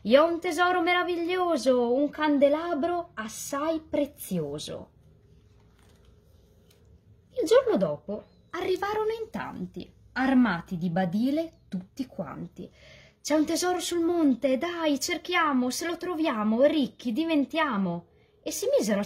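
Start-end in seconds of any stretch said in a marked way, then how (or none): 0.0-4.9 put-on drunken gnome shouting. A narrator's reading. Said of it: "aveva gridato". 13.2-18.1 high, excited urging voices; rally everyone to dig, pitched up; eager treasure hunters. A narrator's reading. Villagers urging each other up the mountain, high excited call.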